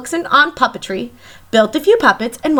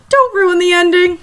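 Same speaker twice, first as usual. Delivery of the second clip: lightly voiced playful scolding, higher and apart from the narrator; teasing aside; 6 semitones higher than usual. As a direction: tease him not to spoil the ending. Jane cuts in on Jim.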